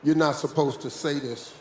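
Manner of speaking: usual speaking voice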